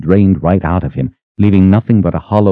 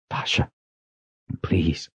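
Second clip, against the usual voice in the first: much softer, gentle pleading; quiet plea; 11 dB quieter, slower than usual. A narrator's reading. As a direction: hush it, begging her to stop. Gently pushes her back, pleading.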